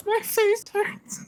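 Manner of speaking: high, breathless laughter; laughing fit. Real, not acted. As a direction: laugh until you can barely speak. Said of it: "Breathe, buddy".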